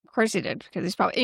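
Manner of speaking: ordinary talk, nothing marked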